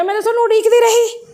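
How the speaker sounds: a patient's words retold with a laugh; laughing while quoting. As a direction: laugh as you retell her waiting.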